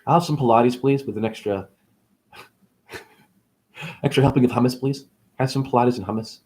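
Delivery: ordinary delivery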